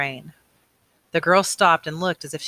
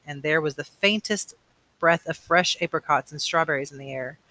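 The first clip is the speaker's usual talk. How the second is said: plain reading voice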